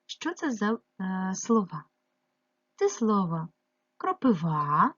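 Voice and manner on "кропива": "кропива" with the final "ва" stretched and rising; stress on last syllable. Shown as a demonstration. Teacher checking for unstressed vowels, stressing the last syllable. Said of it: "у нас не наголошений".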